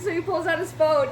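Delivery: ordinary delivery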